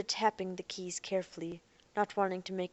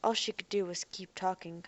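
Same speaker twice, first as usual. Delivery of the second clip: ordinary delivery, nothing marked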